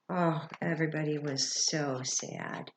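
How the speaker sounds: ordinary talk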